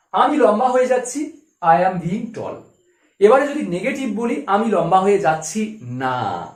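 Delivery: usual speaking voice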